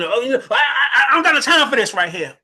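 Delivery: high, loud, laughing mock whine; mocking dismissive whiner. Mocking someone who shuts down.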